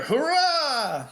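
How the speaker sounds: high, drawn-out mock cheer; crowd cheer. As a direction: cheer like a whole crowd, held back in volume. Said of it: "the crowd lets out a cheer".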